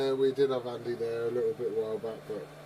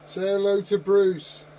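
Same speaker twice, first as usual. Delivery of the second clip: coaxing call; 7 semitones higher, 8 dB louder, slower than usual. Real, not acted. Coaxing child to greet on radio.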